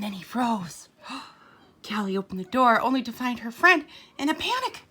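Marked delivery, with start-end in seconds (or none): none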